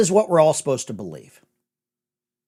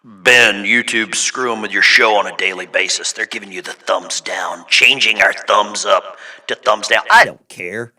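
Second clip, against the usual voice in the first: evil villain voice; 9 semitones lower than usual. Pretend. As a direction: read it like a cartoon villain. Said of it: "Let me do my evil villain voice".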